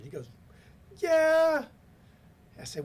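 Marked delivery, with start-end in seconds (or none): 1.0-1.7 long, very high, loud mock yell; hammed-up frustrated holler; retold angry scream. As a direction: imitate a furious caller yelling back. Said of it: "screaming at wh-, whoever was in his car".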